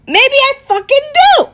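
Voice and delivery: high, loud defiant shout; defiant retort. Acted. Acted retort in the quarrel.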